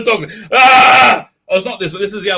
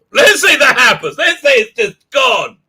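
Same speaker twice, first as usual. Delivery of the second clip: mock shout; 6 semitones higher, 4 dB louder than usual. Acting out a hotel guest.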